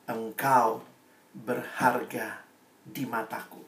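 soft, slow, almost fading whisper of a promise; tender hushed reading; hushed verse. Shown as a demonstration. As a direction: drop right down and let it sink in. Same verse repeated to youths.

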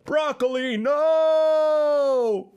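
high anguished shout; anguished cry. Acted. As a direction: cry out in despair. Crying out for fallen friend.

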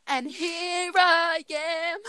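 high, slow theatrical delivery; sing-song stage line, drawn out; dramatic show line. Acted.

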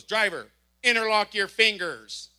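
slow, loud cop command; stern police order. Retold or imitated. Officer ordering the driver, slow loud stern command. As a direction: lay down each word like a stern officer. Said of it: "she goes".

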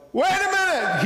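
sudden high, loud cry of discovery; excited shout. Retold or imitated. Preacher voicing the chess fan at the painting, sudden excited shout. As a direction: yell it like you just spotted the answer. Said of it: "he shouted".

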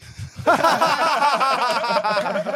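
loud, high burst of laughter. Real, not acted. Big laugh after punchline.

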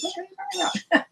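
very high, fast mock incredulity; baffled disbelief. Naive listener hears about labs in China, baffled. Retold baffled reply to neighbor.

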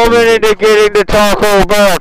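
yelling it out triumphantly; shouting. Real, not acted. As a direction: bellow the brag into the mic. Bragging to friends on voice chat.

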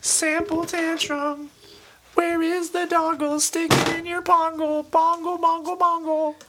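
high, slow nonsense chant; silly singsong. Pretend. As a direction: goof around in a high sing-song voice. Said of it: "silly singsong nonsense".

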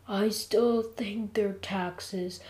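faint, slow, soft voice; drained, barely getting the words out; weak. A narrator's reading. Jeff insists on taxes while sweating like crazy, weakly. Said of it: "I said weakly".

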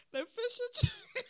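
high, loud, laughing. Real, not acted. Vlogger repeating her joke, high loud laughing. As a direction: squeal the name through laughter.

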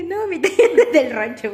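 high, loud joking comeback; loud playful retort, laughing inside; mock loud retort. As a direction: fire back a hammed-up excuse. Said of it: "Y le dije".

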